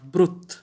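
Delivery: ब्रुत with the vowel kept short. Shown as a demonstration. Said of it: "लंबा खींच के नहीं पढ़ेंगे, बल्कि शॉर्ट".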